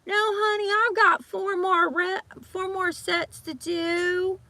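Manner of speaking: high, slow, put-on sweet voice; mock gym hog. Gym hog brushing her off, slow and high-pitched. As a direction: drag out "No, honey" in a high syrupy voice. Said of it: "people who wanna go sit".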